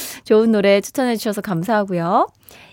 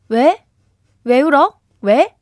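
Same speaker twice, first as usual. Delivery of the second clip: louder, playful prodding with rising ends; teasing questions; 4 semitones higher, 5 dB louder than usual. Acted. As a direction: needle them playfully about why they cry. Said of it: "다들 놀리냐고 하셨는데". (놀리듯 왜냐고 캐묻기)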